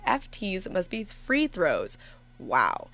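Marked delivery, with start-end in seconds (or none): none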